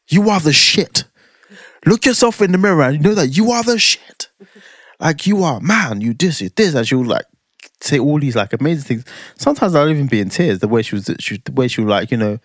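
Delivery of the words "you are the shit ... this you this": a hint of the wife, higher, half-whispered hype; hyping him up like she does, lightly voiced; wife's pep talk. Husband voicing wife's morning pep talk, lightly mimicked. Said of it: "she just give me this pep talk".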